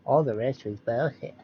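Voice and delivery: lightly voiced fake-tough politician; a hint of a posturing politician cussing; mocking impression. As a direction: ham up a senator trying to sound tough. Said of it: "Look how fake that is".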